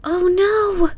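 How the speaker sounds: high, loud wail of dismay. Acted. Kiki sees her mother's broom snapped, wailing. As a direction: cry out at the broken broom. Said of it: "Kiki wailed".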